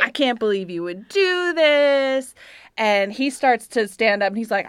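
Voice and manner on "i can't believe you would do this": high, drawn-out mock disappointment; epically dramatic. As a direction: lay on the disappointed mom, slow and high. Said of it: "being epically dramatic".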